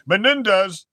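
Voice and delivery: ordinary talk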